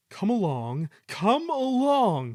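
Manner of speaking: straining engine urging; puffing. A narrator's reading. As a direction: heave the second one out long. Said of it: "he puffed".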